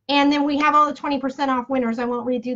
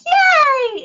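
high, loud squeal of joy; excited cheer; 13 semitones higher, 6 dB louder than usual. Real, not acted. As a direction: cheer loudly, way up in pitch. Cheering before naming the winner.

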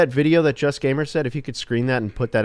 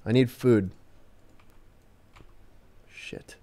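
ordinary delivery

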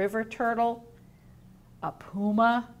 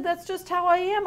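a content sloth, pitched up; higher, cheerful sloth voice; 9 semitones higher, 4 dB louder than usual. Acted. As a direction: say it proudly and lightly. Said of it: "He said".

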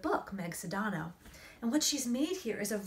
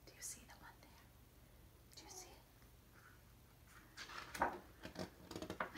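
softer, breathy hushed question; whisper; 10 semitones higher, 18 dB quieter than usual. Acted. Mom whispering to her child on the dark road. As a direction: whisper so the salamander won't startle. Searching for salamanders at night.

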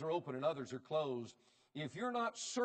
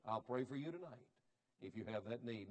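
normal talking voice

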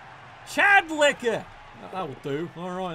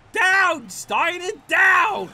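yelling at the screen; loud frustrated shout; 5 semitones higher, 5 dB louder, much slower than usual. Real, not acted. Shouting at the game.

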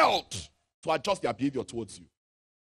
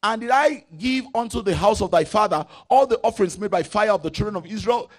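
loud, raised preaching read; preaching scripture; 3 semitones higher, 9 dB louder than usual. Acted. Preacher reading God's words aloud, booming. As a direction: proclaim the verse to the congregation.

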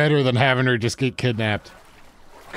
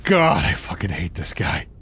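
muttered annoyance; 8 semitones lower than usual. Real, not acted. Muttered frustration at enemy.

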